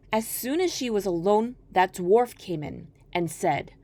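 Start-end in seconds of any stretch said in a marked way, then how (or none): none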